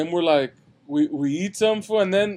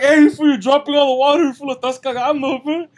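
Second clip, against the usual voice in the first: yelling at dad; 6 semitones higher, 6 dB louder than usual. Retold or imitated. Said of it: "I was like".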